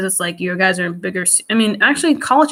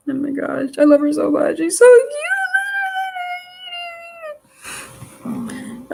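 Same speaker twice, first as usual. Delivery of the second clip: higher, gushing voice with a drawn-out "cute"; gushing adoration. Real, not acted. Showing viewers pictures of beloved Zora, gushing adoration. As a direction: gush and stretch out the last word.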